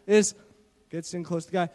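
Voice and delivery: ordinary delivery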